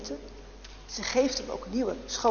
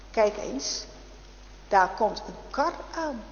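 plain reading voice

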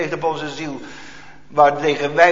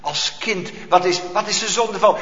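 usual speaking voice

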